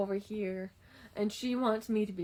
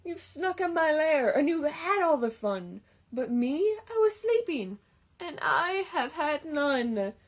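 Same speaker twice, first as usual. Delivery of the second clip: louder, higher complaining bear; grumpy bear; 5 semitones higher, 6 dB louder than usual. Acted. Bear complaining to his friends, loud and grumpy. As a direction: whine like a cross, sleepy bear.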